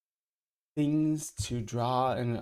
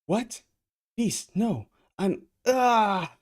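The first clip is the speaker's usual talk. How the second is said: high alarmed shout; startled cry; 11 semitones higher, 8 dB louder, much slower than usual. Acted. Heroine attacked by Beast.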